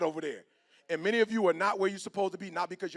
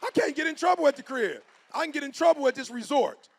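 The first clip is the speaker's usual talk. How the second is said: emphatic punchline; 7 semitones higher, 8 dB louder than usual. Retold or imitated. Punchline of the retold exchange.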